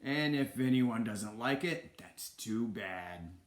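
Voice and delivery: slow, heavily stretched decree; hammed-up tyrant's ultimatum; Ratigan the king. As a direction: lay down the law, drawing out each phrase. Villain lays down the law.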